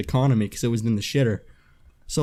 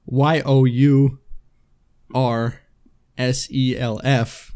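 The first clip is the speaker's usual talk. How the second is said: slow, spelled letters; slow spelling; slower than usual. Retold or imitated. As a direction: spell each letter out slowly. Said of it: "spelling out slowly".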